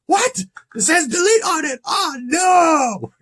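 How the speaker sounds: high-pitched hammed-up horror, wailing the last word; horrified Chris Jericho. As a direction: act out panicked disbelief way up high. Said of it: "He's like".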